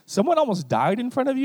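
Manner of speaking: high, loud mock disbelief; shocked disbelief. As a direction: gasp at it like you can't believe it.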